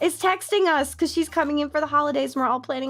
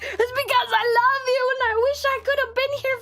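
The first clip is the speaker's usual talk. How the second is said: tearful confession; 11 semitones higher, faster than usual. Retold or imitated. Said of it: "And I'm like".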